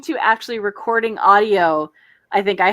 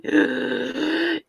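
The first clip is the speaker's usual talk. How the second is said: sharp excited gasp; a sudden, breathless gasp of delight; 2 semitones higher, 6 dB quieter than usual. Real, not acted. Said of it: "What was that noise".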